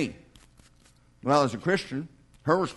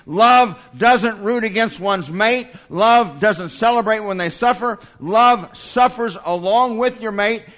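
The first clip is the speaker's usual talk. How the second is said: slow, louder building sermon cadence; full-force preaching; 4 dB louder than usual. Acted. Preacher to congregation, slow louder preaching on love. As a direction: preach it, hammering never.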